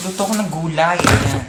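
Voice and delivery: ordinary delivery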